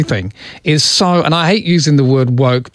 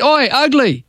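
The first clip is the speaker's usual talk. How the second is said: high, cheeky mock yell across the street; 12 semitones higher than usual. Stranger in the street hailing Phil, a cheeky mock yell. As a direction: holler it like a larrikin hailing a mate. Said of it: "people yell out in the street".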